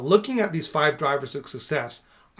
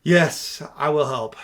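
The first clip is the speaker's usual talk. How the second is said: forceful, raised-voice agreement; mismatched intonation; 6 dB louder, slower than usual. Shown as a demonstration. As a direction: blurt "Yes" loudly, pause, push out "I will help".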